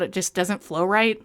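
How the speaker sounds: ordinary talk, nothing marked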